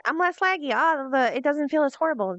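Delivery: high-pitched, upbeat self-quote; mock relief. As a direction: pipe up high, imitating your own premature relief. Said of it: "I'll be like".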